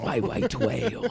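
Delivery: slow mock sea-captain voice at normal pitch; Captain Ahab. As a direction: draw it out as a whaling captain. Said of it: "Fuck, I'm Captain Ahab".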